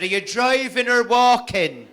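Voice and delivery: high, slow put-on voice; high slow quoting voice; mimicked caller. Retold quote, mimicked voice.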